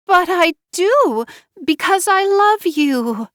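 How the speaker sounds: high tearful wail, acted; crying. Wife insisting she loves him, high tearful plea. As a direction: plead through mock tears. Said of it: "She started to cry harder".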